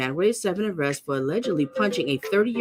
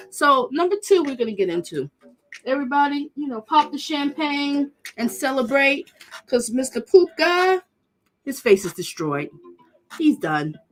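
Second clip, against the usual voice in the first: much higher, excited announcing; gleeful; 8 semitones higher than usual. Real, not acted. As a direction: pop the champagne with glee. Celebrating his downfall.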